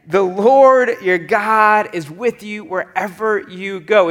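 loud, slow reassurance proclaimed; emphatic scripture repeat. A narrator's reading. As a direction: ring out each key word, stretched.

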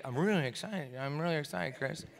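soft, unexcited deadpan impression; Ken's calm "excitement". Brother imitated claiming great excitement, deadpan calm. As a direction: say you're thrilled, quietly and without any excitement. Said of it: "so that's how he was".

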